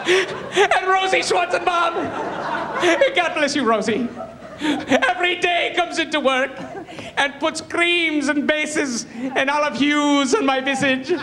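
high, slow, mock-sobbing acceptance speech; James Lipton, weeping speech. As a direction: blubber through the thanks, grandiose to the end. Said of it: "he starts crying at the very end".

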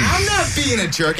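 high, slow, drawn-out protest; indignant denial. Real, not acted. Accused friend denying it to the group, indignant. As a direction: push back, stretched and high. Denies being called a jerk.

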